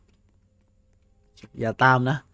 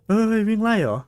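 startled yelp, much higher and louder; 10 semitones higher, 5 dB louder than usual. Real, not acted. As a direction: jump in surprise at the chaser. Chased by a monster in game. (ตกใจร้องเสียงสูง)

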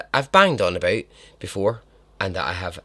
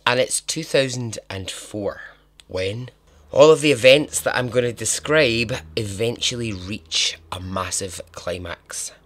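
ordinary talk, nothing marked

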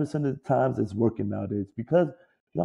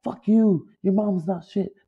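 mock heckler yell; 8 semitones higher, 3 dB louder, slower than usual. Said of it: "they call me [name]".

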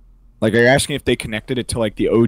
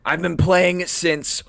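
Trump impression; 8 semitones higher, 4 dB louder than usual. Trump bragging about old Call of Duty, Trump voice. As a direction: brag like Trump on a rally stage.

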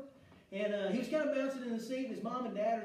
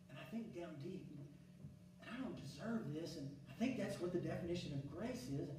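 hushed preaching; 4 semitones lower, 11 dB quieter than usual. Acted. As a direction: drop to a near hush as if sharing a secret. Hushed reflection on the cross.

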